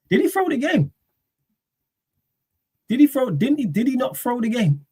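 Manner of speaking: mock the idea in a squeaky voice; mock suspicious squeak. Mocking his own pundit friend, high squeaky accusation. Mocking question about throwing game.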